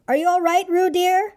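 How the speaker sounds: high-pitched worried mother; high, anxious motherly calling. A narrator's reading. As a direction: call out high, fretting for your child. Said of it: "called Kanga anxiously".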